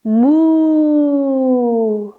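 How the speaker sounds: drawn-out playful moo; cow moo. Shown as a demonstration.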